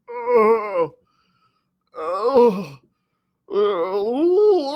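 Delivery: woozy, drawn-out moans; dazed groan. Acted. Dog groaning dazed after hitting the fence. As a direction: moan like you just got knocked silly.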